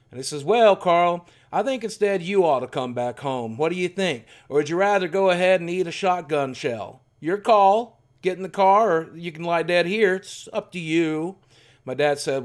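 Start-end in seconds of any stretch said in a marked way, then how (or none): 0.4-11.4 louder, higher mock menacing old man; a lightly voiced grandfather giving an ultimatum; threatening grandfather. Grandfather threatening his son with a shotgun, in a loud menacing voice.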